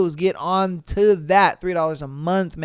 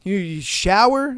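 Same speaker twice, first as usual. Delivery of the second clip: mock teasing; 3 semitones higher, 5 dB louder than usual.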